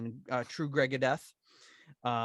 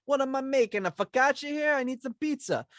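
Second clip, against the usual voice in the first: higher, louder hammed-up pizza chef; mock Italian cook; 10 semitones higher, 3 dB louder than usual. Host teasing co-host about thin crust, mock Italian chef. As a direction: play an indignant Italian mama, over the top.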